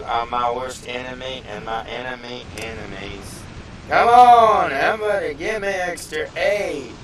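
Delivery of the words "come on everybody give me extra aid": loud high call to the team; calling for help. Real, not acted. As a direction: yell to teammates for support.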